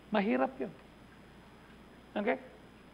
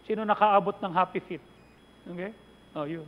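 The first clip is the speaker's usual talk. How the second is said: ordinary talk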